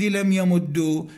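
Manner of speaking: "يمد" ending in "u", yamuddu; damma ending. Shown as a demonstration. Teacher listing allowed forms for parsing, "يمد" with damma. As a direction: say "يمد" with doubled d and "u". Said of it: "الضم يجوز تبعا لضمة العين".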